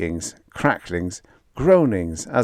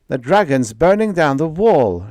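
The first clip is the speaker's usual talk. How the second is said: louder, higher panicked shriek; 7 semitones higher, 6 dB louder than usual. Acted. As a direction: cry the alarm. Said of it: "shrieked Lazar".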